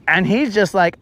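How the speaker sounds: usual speaking voice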